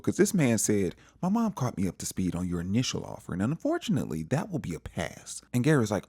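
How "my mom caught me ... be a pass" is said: business mode. Retold or imitated. Son turns down the offer in a mild business tone. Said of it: "he was definitely in business mode".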